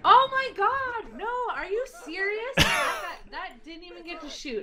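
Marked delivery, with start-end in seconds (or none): 0.0-2.5 can't believe the unit died; outraged disbelief. Real, not acted. Outraged at lost tank.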